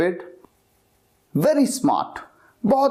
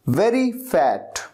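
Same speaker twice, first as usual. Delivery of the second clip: normal talking voice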